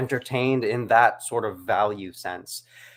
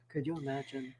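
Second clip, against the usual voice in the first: whispered; 15 dB quieter than usual. Shown as a demonstration.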